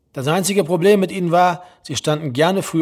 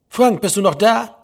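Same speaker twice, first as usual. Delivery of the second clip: higher, louder questioning voice; mother calling down the line; 5 semitones higher, 5 dB louder than usual. A narrator's reading. Mother to silent son on the phone, insistently calling. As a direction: call out to check he's there.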